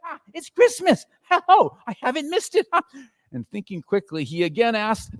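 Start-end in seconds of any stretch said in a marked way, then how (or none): 0.0-3.3 high, delighted exclamation; bursting with relief and glee; old man giddy with joy; overjoyed Scrooge. A narrator's reading. Scrooge overjoyed it's Christmas.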